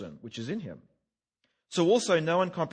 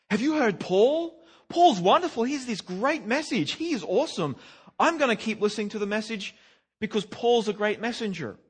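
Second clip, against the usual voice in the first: higher, louder starstruck praise for Paul; gushing fan; 7 semitones higher, 4 dB louder than usual. Retold or imitated. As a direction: gush like a starstruck admirer of a great speaker. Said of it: "I don't want you hearing the gospel and saying".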